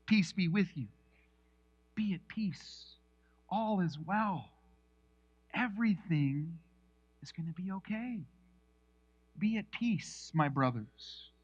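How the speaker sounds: soft, calming Jesus; gentle reassurance. Acted. Jesus calming startled disciples, soft and tender. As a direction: soothe frightened friends softly. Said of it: "Okay, actually, he says".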